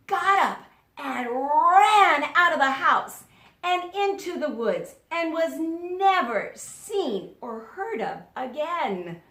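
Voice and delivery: excited telling. A narrator's reading. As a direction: build the chase excitedly.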